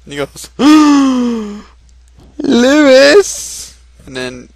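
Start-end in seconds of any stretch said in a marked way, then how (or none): 0.5-1.7 big, high, loud gasp; dramatic gasp. Acted. Character reacts to the disaster. 2.4-3.8 high, very loud call of the name; desperate shout. Acted. Character screams for lost friend.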